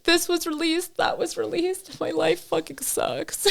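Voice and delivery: high, soft mock wail; mock anguish. Joking client voicing the pain of each tattoo stroke, mock anguish. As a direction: squeak out hammed-up misery, drawn out at the end.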